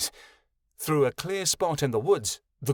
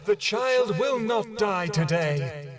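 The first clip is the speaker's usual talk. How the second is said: higher, slow, knowing reassurance; sly goddess; 7 semitones higher than usual. A narrator's reading. Great One slyly reassuring Kyoto. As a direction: promise it with a foxy grin. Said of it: "grinned at them foxily".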